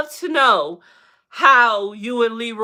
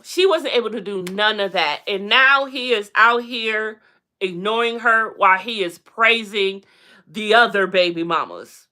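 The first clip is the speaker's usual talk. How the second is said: usual speaking voice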